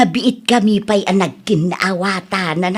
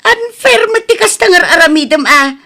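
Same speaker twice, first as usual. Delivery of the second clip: higher and louder, sulking protest; mother whining at daughter, higher and louder; whiny complaint; 10 semitones higher, 5 dB louder than usual. Acted. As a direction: play the wronged mother.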